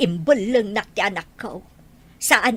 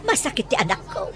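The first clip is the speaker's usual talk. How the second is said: ordinary delivery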